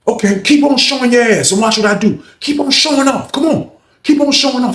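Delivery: high-pitched dare; angry warning. Retold or imitated. Acts threat in quarrel.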